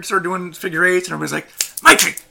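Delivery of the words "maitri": calling out. Acted. Everybody calls out the name.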